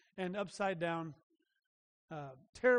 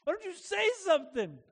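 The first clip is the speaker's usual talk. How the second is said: put-on higher, fast, desperate child's plea; pleading child; 7 semitones higher than usual. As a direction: beg dad to do something, pitched up. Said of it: "she's looking at me going".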